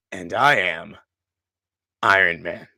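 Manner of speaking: low, slow heroic reply; a hint of Tony's quiet triumph; Iron Man quote. Quoting Tony's final answer to Thanos, low and solemn. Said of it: "and he goes".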